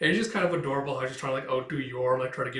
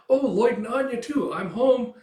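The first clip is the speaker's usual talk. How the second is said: much higher, louder bright hello; sing-song higher I'm-home call; cheerful greeting; 10 semitones higher, 6 dB louder than usual. Retold or imitated. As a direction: call out happily on coming home. Yor arriving home cheerfully.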